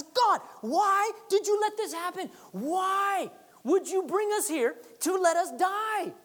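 high, slow, lamenting cry; anguished plea. Acted. Joshua crying out to God, high and pleading. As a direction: plead upward like a grieving leader.

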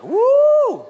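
loud, high "woo"; retold cheer at full voice; delighted whoop. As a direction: let out a long, high celebratory whoop. Cheers son's answer in retelling.

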